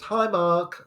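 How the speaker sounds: a cheerful mum answering the phone; mum's voice. Retold or imitated.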